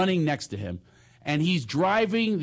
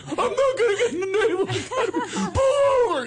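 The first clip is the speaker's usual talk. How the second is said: mock crying wail; 3 dB louder than usual. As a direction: wail like a goody-goody about to cry. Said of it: "Now he's almost in tears".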